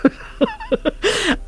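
high-pitched burst of laughter; real laugh. Real, not acted. Woman remembers the nun's little feet dangling, high amused laugh. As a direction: break up laughing at the memory.

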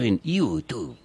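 YouTube drawn out slowly as "iu-tu"; imitated pronunciation. Host mimics Julio Sánchez Cristo saying YouTube as "iu-tu". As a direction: say YouTube slowly with a vowel-like "iu" start. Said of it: "dice él".

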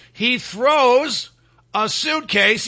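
normal talking voice

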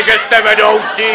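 usual speaking voice

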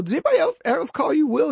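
high, light friendly question; lightly voiced curious buddy. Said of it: "I go".

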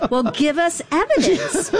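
high-pitched, half-laughing demand; retold exasperated plea. Digital rights group begging tipsters for proof, high exasperated plea.

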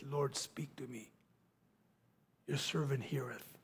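soft, low, awed reply; reverent hush. Retold or imitated. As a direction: answer humbly, almost breathing it. Boy answers God at night.

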